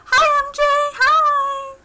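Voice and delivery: very high, loud squeal of hello; excited greeting. Real, not acted. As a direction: squeal hello to a favorite viewer. Greeting a chat viewer.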